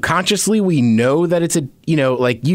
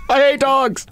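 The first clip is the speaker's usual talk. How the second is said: mock mean line; 10 semitones higher, slower than usual. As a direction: say it like the cruellest thing imaginable. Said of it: "Say something mean".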